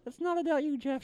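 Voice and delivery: high puppet voice; puppet retort. Acted. Puppet puts his ventriloquist in his place, high puppet retort. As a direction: cut in as a small, high-pitched puppet.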